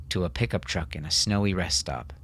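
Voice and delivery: plain reading voice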